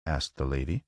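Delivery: ordinary delivery, nothing marked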